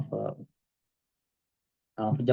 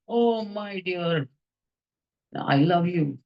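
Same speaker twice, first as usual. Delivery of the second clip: doting mother; 4 semitones higher, slower than usual. Acted. Mother answers child with love.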